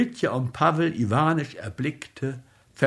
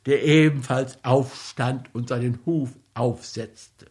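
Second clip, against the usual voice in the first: usual speaking voice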